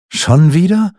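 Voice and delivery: hoch und lauter, fassungslos; ungläubig. A narrator's reading. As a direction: als traue er seinen Ohren nicht. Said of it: "unterbrach der König sie, der seinen Ohren nicht traute". (ungläubig überrascht, deutlich höher)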